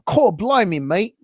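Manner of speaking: hammed-up, badly faked British; mock British geezer greeting, badly done; terrible British accent. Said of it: "my terrible British accent".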